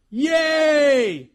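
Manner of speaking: high, loud mock crowd cheer; a big hammed-up yay from the crowd. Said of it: "Crowds cheering".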